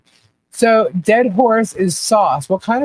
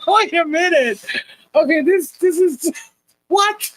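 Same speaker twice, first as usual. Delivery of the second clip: much higher, stammering disbelief; baffled; 9 semitones higher than usual. Real, not acted. Host to chat about ketchup pasta, stunned disbelief. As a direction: stop everything, you're stunned.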